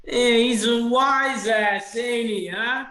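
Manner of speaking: high, drawn-out creature voice; sneering redcap. Acted. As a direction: jeer slowly as a wiseguy little gnome. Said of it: "all of them look over and go".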